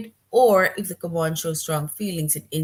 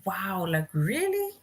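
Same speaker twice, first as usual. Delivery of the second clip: shocked amazement; slower than usual. Shown as a demonstration. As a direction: sound truly amazed, rising at the end. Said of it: "You are shocked".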